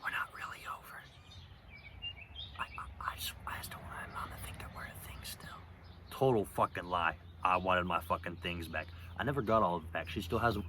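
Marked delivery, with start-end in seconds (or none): 0.0-5.6 breathy whisper into her ear during a hug. Retold or imitated. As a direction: whisper it so no one else hears. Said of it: "I was whispering".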